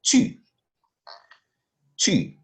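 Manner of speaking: Tu said loud and clear; teacher stresses Tu; emphatic Tu. Shown as a demonstration. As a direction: punch out Tu for the learner. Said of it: "I will say".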